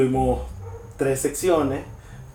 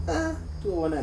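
ordinary delivery

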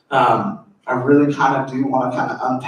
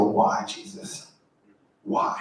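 anguished hushed plea; 4 semitones lower, 7 dB quieter, slower than usual. Retold or imitated. Pleading with Jesus in grief.

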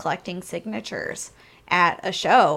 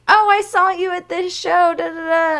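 higher, louder gushing fan; imitating a fan; 9 semitones higher, 6 dB louder than usual. Fan stops her to gush about a show, higher and louder.

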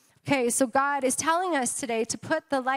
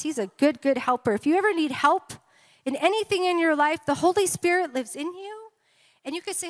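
normal talking voice

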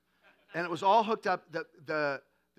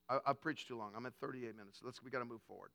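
much lower and softer, muttered to himself; quiet aside; 7 semitones lower, 9 dB quieter, faster than usual. Real, not acted. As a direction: drop out of the sermon, note the time. Aside about running long.

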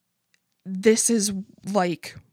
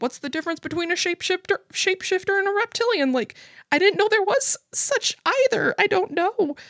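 much higher, rushed confused whine; bewildered question; 10 semitones higher, faster than usual. Retold or imitated. Asking the neighbor about shapeshifters versus reptilians, high and rushed in confusion. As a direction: gabble it high and lost. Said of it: "And I'm like".

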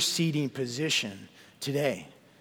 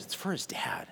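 whisper; 4 semitones higher, 10 dB quieter than usual. Real, not acted. Tender aside about Judah's father.

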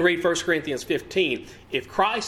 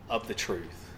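ordinary delivery

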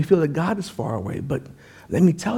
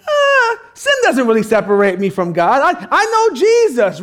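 objecting churchgoer; 6 semitones higher, 5 dB louder than usual. Acted. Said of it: "you're probably sitting there saying".

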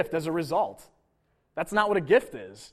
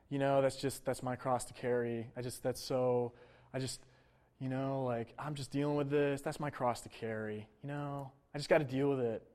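mopey mock lament, much softer; self-pitying; 5 semitones lower, 9 dB quieter than usual. Preacher mocking a believer who calls troubles his cross, softly whining. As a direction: sigh it out like a sorry-for-yourself martyr. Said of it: "some sort of self-pitying humility".

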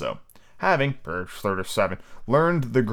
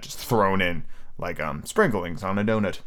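ordinary delivery, nothing marked

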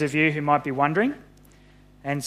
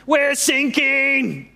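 high, drawn-out alarm cry; acted panicked scream; 9 semitones higher, 3 dB louder, much slower than usual. A narrator's reading. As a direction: stretch it out like a sailor crying out. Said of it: "they screamed".

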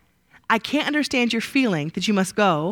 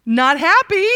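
higher and louder, driving it home; emphatic; 7 semitones higher, 7 dB louder, slower than usual. Pretend. Preacher voicing Laban's anger to the class, loud and high. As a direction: punch it out with mock indignation.

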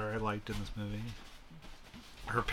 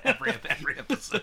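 giggling fast; laughing; 9 semitones higher, faster than usual. Real, not acted. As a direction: laugh while talking. Laughing through words.

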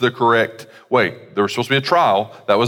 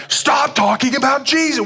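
mock angry crowd. Angry crowd voiced by preacher.

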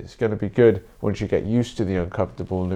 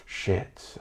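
whispered curse; 10 dB quieter than usual. Retold or imitated. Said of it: "And I was like".

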